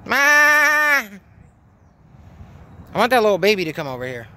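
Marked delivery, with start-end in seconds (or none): 0.1-1.2 bleating baa call; sheep bleat. Retold or imitated.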